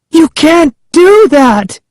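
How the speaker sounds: very high, drawn-out shriek; Sari shrieking. A narrator's reading. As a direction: shriek it in desperate protest. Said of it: "Sari shrieked".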